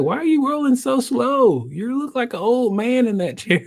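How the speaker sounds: high-pitched heckling; teasing therapist. Retold or imitated. Acted therapist teasing him.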